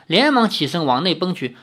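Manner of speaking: plain reading voice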